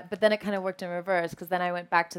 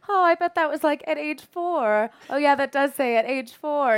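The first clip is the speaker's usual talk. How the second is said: nervous self; 7 semitones higher, 4 dB louder than usual. Retold or imitated. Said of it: "trying to calm myself".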